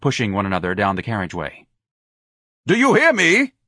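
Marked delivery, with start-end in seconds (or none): 2.7-3.5 high, loud yell. A narrator's reading. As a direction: yell after them. Yelling after girls.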